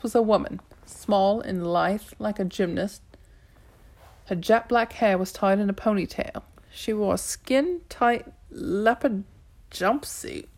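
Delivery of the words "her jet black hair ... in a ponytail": usual speaking voice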